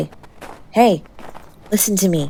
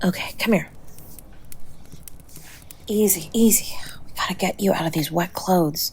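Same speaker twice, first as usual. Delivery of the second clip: soft, much quieter coaxing; gentle whisper; 3 semitones lower, 10 dB quieter than usual. Real, not acted. Undressing the freezing partner gently, whispered. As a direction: ease them in tenderly.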